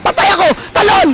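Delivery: high-pitched frantic yells; panicked shouting. Real, not acted. Player urging his character to jump, shouting in panic. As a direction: yell it out in short panicked bursts. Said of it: "Napapasigaw ako sa larong to".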